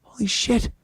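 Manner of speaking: breathless hushed awe; awed whisper. Retold or imitated. Onlooker watching the polite kid, whispering in awe. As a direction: whisper it in disbelief. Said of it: "You're like".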